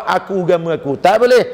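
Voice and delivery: usual speaking voice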